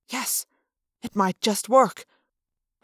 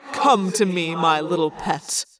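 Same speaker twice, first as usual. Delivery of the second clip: gloating alien commander, slow and menacing; crowing villain; 3 semitones lower, 3 dB louder, slower than usual. Acted. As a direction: croon it like a cruel master calling a pet. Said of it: "Visser Three crowed".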